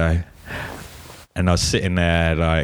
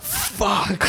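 shocked; 14 semitones higher than usual. Retold or imitated. Shocked reaction to video out.

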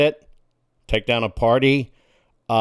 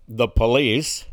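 usual speaking voice

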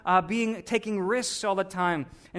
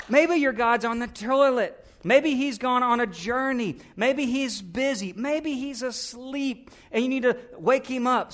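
slow, higher mocking taunt; making fun; 5 semitones higher, slower than usual. Elijah making fun of the Baal prophets, slow mocking jeer. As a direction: drag out each maybe to ridicule them. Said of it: "making fun of him".

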